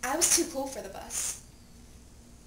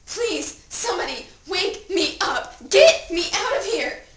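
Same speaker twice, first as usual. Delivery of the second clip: loud, high, distraught cry; desperate plea; 6 semitones higher, 8 dB louder than usual. Acted. Dead teen at own funeral begging to wake, desperate. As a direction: beg frantically to be rescued.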